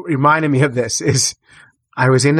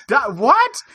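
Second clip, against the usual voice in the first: high, cut-off double take; stumble, then jump up on what; retold shock, pitch leaping high; stunned; 10 semitones higher than usual. Hearing about duckling bait.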